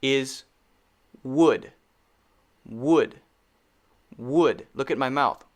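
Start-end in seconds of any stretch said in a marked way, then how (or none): 1.2-4.7 "wood" with lips pushed forward, catching the sound; correct W in wood. Shown as a demonstration. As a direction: round lips and release "Would". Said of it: "the correct pronunciation of this word".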